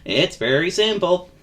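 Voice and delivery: friendly vagabond. Acted. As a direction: confide kindly in a nervous little chap. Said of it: "continued the vagabond".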